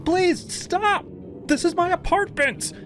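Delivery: put-on girl screaming as she is beaten. A narrator's reading. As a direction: beg him to stop at full pitch. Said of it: "I screamed".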